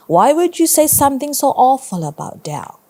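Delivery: a hint of a concerned teacher asking why; lightly voiced stern teacher. Teacher questions the boy.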